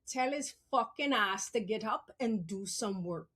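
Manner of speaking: lightly voiced mom, low and deliberate; low mock-stern mom; imitating mother. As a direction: give the order as her mother would. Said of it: "I wanna say it how she said it".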